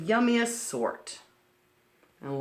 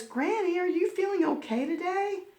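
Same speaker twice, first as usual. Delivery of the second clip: put-on high, gentle worried child voice; concerned girl checking on granny; worried little girl. Red worried about sick Granny, high worried child voice. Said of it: "asked in a worried voice".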